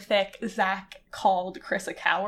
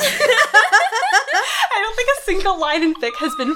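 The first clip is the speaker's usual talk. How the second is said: laughing; 10 semitones higher, 6 dB louder, slower than usual. Real, not acted. Still laughing.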